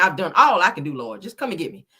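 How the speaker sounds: usual speaking voice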